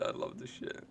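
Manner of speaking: breathy whispered delight; a quiet, gleeful whisper; whispered aside. Real, not acted. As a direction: hush it out with no voice.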